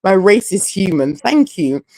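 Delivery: usual speaking voice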